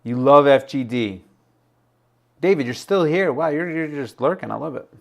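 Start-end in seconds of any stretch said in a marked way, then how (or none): none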